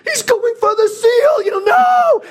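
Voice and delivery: high, loud drawn-out yell; screaming in alarm. Retold or imitated. Family sees the dog going for the seal, screaming in alarm. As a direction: re-enact a panicked family scream, slow and very high. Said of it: "the whole family's screaming".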